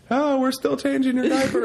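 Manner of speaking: high, breezy cover-up with a laugh; fake casual. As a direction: pretend nothing happened, chirpy and high. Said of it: "I was like".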